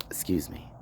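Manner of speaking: quick whispered excuse me; whispered apology. Real, not acted. Apologizing to listeners for the swear, whispered. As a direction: drop to a breath to apologize.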